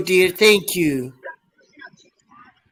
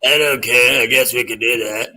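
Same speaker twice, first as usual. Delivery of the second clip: low mock male voice; 6 semitones lower than usual. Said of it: "He says".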